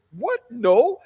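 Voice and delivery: high, loud shocked denial; retold high-pitched disbelief; startled denial. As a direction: act out a stunned, emphatic denial. Acts out partner's startled denial.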